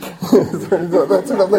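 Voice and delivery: short laugh at own slip; embarrassed chuckle. Real, not acted. Preacher laughing at his own misreading, short chuckle. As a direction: laugh off the mistake. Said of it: "that was a slip".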